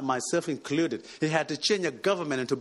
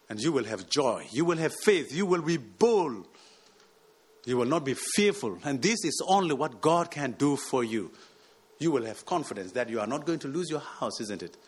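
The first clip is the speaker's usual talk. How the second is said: ordinary delivery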